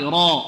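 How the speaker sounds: را loud, with a thick emphatic R; heavy ra. Shown as a demonstration. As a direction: model a heavy را for students to repeat. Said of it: "انطقوا معي".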